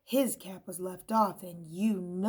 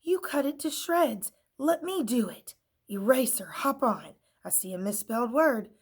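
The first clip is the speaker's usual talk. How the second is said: higher exasperated take-over; bossy stapler; 5 semitones higher, 6 dB louder than usual. A narrator's reading. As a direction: push in impatiently.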